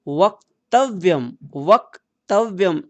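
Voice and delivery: वक्तव्यम said slowly, half क and half व joined clearly; careful pronunciation. Shown as a demonstration. Teacher models वक्तव्यम for children, slow and careful. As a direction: spell out वक्तव्यम syllable by syllable, slow. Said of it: "क को आधा रखेंगे".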